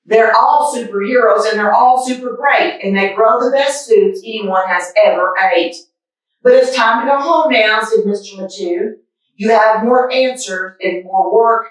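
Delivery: lively picture-book read-aloud; reading a story to kids. A narrator's reading. Reading a picture book to boys and girls, lively storytime voice. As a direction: read it like storytime for little ones.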